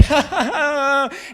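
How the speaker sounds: loud, high wailing laughter; imitated cry-laugh. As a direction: mimic someone laughing until they sob. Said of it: "cry laughing, and she's like".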